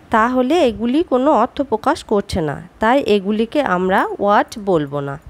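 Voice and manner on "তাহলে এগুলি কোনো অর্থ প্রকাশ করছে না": ordinary talk, nothing marked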